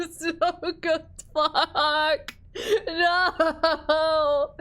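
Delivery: loud, high wail through laughter; laughing anguish. Real, not acted. Player forced to choose a dish, laughing anguished wail. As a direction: wail in mock agony, laughing. Agonised at being asked to choose.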